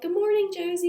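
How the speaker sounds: high, lilting imitation of a sweet four-year-old; sweet child greeting. Big sister greeting her baby sister, sweetly. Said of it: "she's being so sweet".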